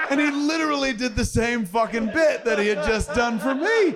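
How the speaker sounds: telling it through bursts of laughter; breaking up mid-story, fast and loud; laughing. Real, not acted.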